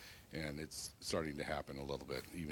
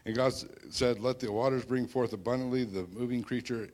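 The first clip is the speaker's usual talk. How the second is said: loud, raised scripture reading; booming biblical narration; 5 semitones higher, 6 dB louder than usual. Acted. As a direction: proclaim the verse. Reading Genesis aloud.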